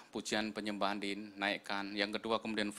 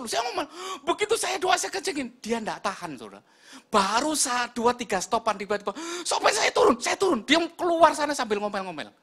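high, shouted storytelling; full-force preaching; 12 semitones higher, 9 dB louder than usual. Real, not acted. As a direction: shout the escalating story to the congregation.

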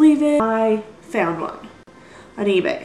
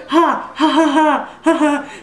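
mock laugh; 5 semitones higher, 4 dB louder, slower than usual. Mock gloating laugh at Gina.

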